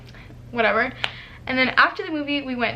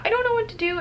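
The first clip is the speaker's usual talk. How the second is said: high, flustered dithering; mild mock panic; retold freaking out; 10 semitones higher, faster than usual. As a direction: fret in a pitched-up voice. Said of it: "I was, like, freaking out".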